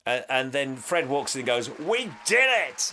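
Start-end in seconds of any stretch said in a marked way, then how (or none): 1.8-2.9 triumphant cheer. Retold or imitated. Fred walks in as everyone cheers, triumphant. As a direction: celebrate it at a high pitch.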